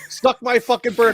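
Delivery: all-caps yell. Retold or imitated. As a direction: read it out like an all-caps text. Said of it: "you sent all caps".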